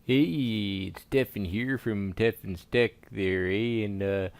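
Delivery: hammed-up Canadian accent; thick comic Canadian accent. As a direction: play it as a folksy Canadian with a sing-song "eh". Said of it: "you sound like one of them there Canadians".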